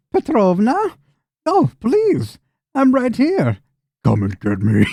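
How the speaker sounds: high cackling hag voice; old hag. Acted. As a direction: taunt them like a cackling witch. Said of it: "you hear a cackling voice".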